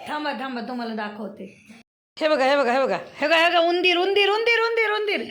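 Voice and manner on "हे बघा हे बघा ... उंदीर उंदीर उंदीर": loud, excited calling out; excited shout. Real, not acted. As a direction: call everyone to look, thrilled. Calls out seeing the mouse. (उत्साहात मोठ्याने हाक)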